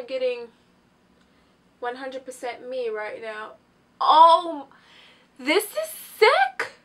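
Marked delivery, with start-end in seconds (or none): 4.0-6.7 much higher and louder, slow amazed cry; real excited gasp at the result. Real, not acted. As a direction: let the amazement burst out. Amazed at makeup coming off.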